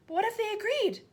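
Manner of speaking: put-on high, loud hopeful child; a girl seizing on an idea; eager. A narrator's reading. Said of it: "Matilda cried eagerly".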